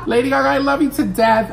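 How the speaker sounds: giggling declaration of love; laughing gush. Real, not acted. Calling out to [name] through a laugh.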